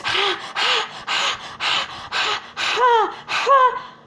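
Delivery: high breathy ha ha after burning food; panting ha after spicy food. Shown as a demonstration. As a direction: gasp ha ha as if the mouth is on fire. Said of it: "You take something like this and spicy and eat".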